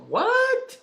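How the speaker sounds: very high, drawn-out questioning squeak; exaggerated retold confusion; baffled. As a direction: pitch it way up in disbelief. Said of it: "that's where I got confused".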